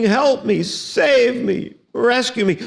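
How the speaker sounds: mock terrified scream. Drowning girl cries for rescue, mock pleading cry. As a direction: play the drowning girl crying out, lightly. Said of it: "she's screaming".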